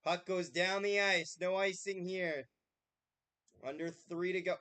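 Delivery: play-by-play. Acted. Mock play-by-play commentary.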